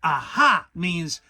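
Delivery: a self-important rabbit holding forth; fussy Rabbit voice. A narrator's reading.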